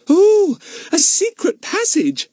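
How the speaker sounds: high, slow, thrilled gasp of delight; excited girl. Acted. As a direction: breathe it out with wide-eyed thrill. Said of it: "her eyes shining".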